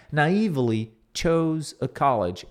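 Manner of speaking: slow, clear "Naively chose a college"; slow model reading. Shown as a demonstration. Teacher modelling slowly. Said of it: "Detalhe aqui no chose".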